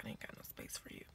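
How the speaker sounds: whispered aside. Retold or imitated. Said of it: "I'm like".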